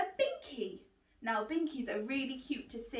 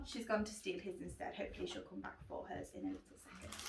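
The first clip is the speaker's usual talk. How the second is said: whispered aside; 4 semitones lower, 9 dB quieter, faster than usual. Real, not acted. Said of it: "whispered aside about rabbit".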